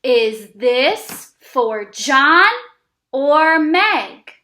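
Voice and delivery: put-on slow, stretched reading for kids; teacher reading a sentence for kids to repeat, slow and clear; slow read-along. Shown as a demonstration. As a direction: read each word slowly and clearly. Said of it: "let's read the sentence one more time together".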